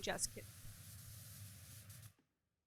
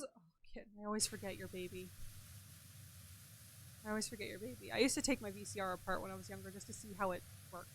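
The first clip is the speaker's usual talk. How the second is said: much softer, close hushed voice; hushed aside; 3 semitones lower, 16 dB quieter, faster than usual. Real, not acted. Streamer speaking softly into the mic, hushed. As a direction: drop to a near whisper.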